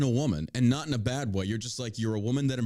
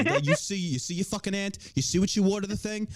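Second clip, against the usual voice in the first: pitched-up Italian-American relative gossiping; gossiping Italian family; 6 semitones higher than usual. Acted. Host mimicking an Italian family trashing an aunt, mock-outraged gossip. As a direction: play a scandalized Italian relative. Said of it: "Now you sound like an Italian family".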